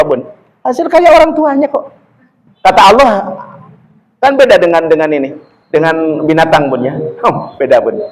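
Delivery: ordinary talk, nothing marked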